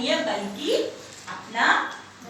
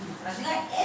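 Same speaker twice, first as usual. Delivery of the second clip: ordinary delivery, nothing marked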